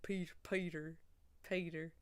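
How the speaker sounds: quiet, half-hearted cartoon accent; tentative tries at a cartoon voice, softly; attempted Family Guy voice. Streamer testing a voice they can't really do, tentative impression. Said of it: "I can't really do that voice".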